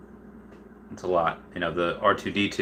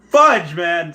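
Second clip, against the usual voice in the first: frustrated; 7 semitones higher, 7 dB louder than usual. Real, not acted. Frustrated outburst after rant.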